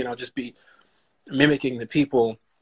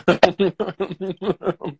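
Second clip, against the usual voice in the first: high-pitched burst of laughter; 7 semitones higher, 4 dB louder than usual. Real, not acted. Actor amused by the interviewer's high five, laughing. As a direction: laugh out loud, high and sustained.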